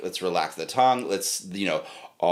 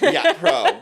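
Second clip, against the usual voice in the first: laughing; 5 dB louder than usual. Real, not acted. Joking about professional range.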